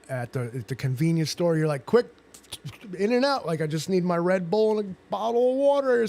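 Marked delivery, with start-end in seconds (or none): 1.9-6.1 higher-pitched hurried customer voice, quick in and out; impatient shopper. Retold or imitated. Mimicking a rushed customer at the convenience store, higher pitched. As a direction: mimic the rushed guy wanting only his Red Bull.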